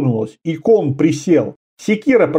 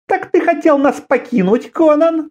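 much higher woman's mocking question; female voice; 11 semitones higher than usual. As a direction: raise the voice high for the woman. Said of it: "сказала она".

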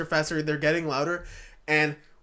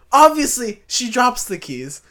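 loud, high, exasperated retelling; loud storytelling exasperation, higher pitched; emphatic; 8 semitones higher, 11 dB louder than usual. Real, not acted. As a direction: hit OBVIOUSLY high and loud. Repeats line emphatically after interruption.

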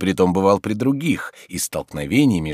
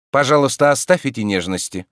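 irritated interruption; 7 semitones higher, 5 dB louder than usual. Acted. Oblomov cuts him short.

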